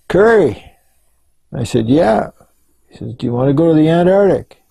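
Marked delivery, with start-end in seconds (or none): 0.1-0.7 high, louder call across the room; retold shout. Card-playing colleague shouting to him on the bunk, retold shout. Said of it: "one of them shouts". 1.9-2.3 high, rising answering call; called-back answer. Retold or imitated. Man on the bunk answering his colleague, retold call-back. 3.1-4.6 quick, higher retold call; called-out invitation. Colleague inviting him south from the card table, retold call.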